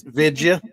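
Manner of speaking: "vidya" said as VID-juh; Jerry Jones's pronunciation. Retold or imitated. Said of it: "as Jerry Jones calls it".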